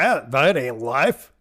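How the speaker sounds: loud, slow Southern drawl; Southern accent. Acted. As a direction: exaggerate a drawn-out Southern accent. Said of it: "Doss has a Southern accent".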